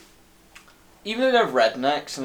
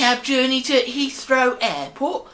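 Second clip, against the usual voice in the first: high, loud fake London cabbie accent; hammed-up London accent, pitched well up; bad London accent; 10 semitones higher, 4 dB louder than usual. London cabbie offering a ride to Heathrow, bad London accent. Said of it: "bad accents".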